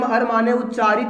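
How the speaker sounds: ordinary delivery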